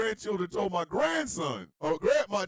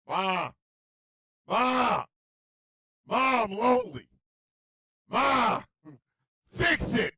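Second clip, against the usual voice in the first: loud, raised, punchy demands; mock-menacing little girl; Denzel-Bane toddler; 5 semitones higher, 4 dB louder, slower than usual. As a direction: holler for mom, insistent. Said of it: "in my Disbane voice".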